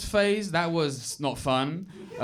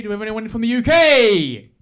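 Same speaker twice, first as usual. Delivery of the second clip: loud, raised call to the crowd; shouted crowd call-out; 5 semitones higher, 10 dB louder than usual. Real, not acted. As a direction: hype the room up, ending in a shout. Calling out to the crowd.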